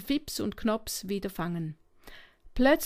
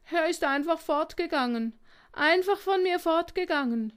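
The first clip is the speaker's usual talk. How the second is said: put-on higher, plaintive child's lament; understated sob, pitched up; sobbing lament; 6 semitones higher than usual. A narrator's reading. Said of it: "schluchzte der Kleine".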